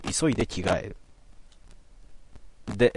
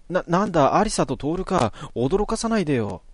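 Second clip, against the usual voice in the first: put-on a lightly raised voice for a startled boy, easing off; Natsumi, relieved; 7 semitones higher than usual. Natsumi relieved to find Alisa and Tooru, lightly higher voice. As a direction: play him shaken, then scolding gently. Voicing Natsumi in game text.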